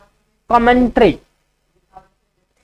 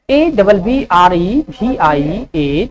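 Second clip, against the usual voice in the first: slow letter-by-letter dictation; slow spelling dictation; spelling out slowly; 2 semitones lower, much slower than usual. Shown as a demonstration. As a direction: spell each letter clearly for students. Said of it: "क्या हुआ?".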